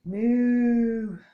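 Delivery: loud long mooing; big cow moo. Pretend. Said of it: "Are you ready?".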